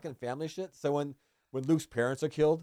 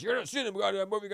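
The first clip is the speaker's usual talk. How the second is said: high-pitched blustering dad impression; mock angry dad; 8 semitones higher than usual. Dad refusing his son on the phone, mock angry rant. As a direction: imitate a fed-up father ranting.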